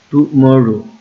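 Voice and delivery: Tomorrow with a loud push on মর; stressed syllable demo. Shown as a demonstration. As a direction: punch the মর in tomorrow. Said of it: "এটা হচ্ছে আমার stress word". (টুমরো-র মর অংশে জোরে চাপ)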